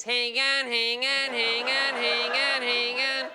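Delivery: high, looping siren made of words; bogan ambulance. Acted. Comic imitating a bogan ambulance, steady high siren chant. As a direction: wail it over and over like a siren. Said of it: "like a bogan ambulance".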